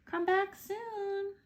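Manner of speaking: high, slow, sing-song farewell; calling unicorn. Acted. Unicorns calling farewell to Kelp, high and drawn out.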